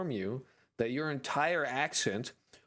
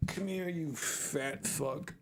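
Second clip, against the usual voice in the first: straining while lifting; 5 semitones higher, slower than usual. Real, not acted. Hoisting up the heavy cat.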